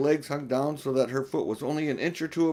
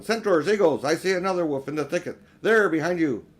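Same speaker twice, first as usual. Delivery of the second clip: loud, fast, higher commands; rally the troops in a rush; shouting orders; 4 semitones higher, 6 dB louder than usual. Acted. Aslan sends centaurs and eagles after the wolf, shouting. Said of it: "shouted the voice of Aslan".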